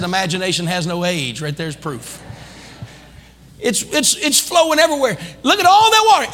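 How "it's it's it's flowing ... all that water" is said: rising high and loud, faster; amazed. Pretend. Grandpa showing grandson the flowing river, amazed. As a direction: marvel at the rushing water.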